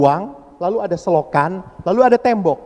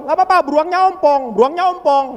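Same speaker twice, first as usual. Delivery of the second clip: much higher, playful joking; playful reassurance; 11 semitones higher, faster than usual. Retold or imitated. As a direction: tease them along. Priest joking to kids.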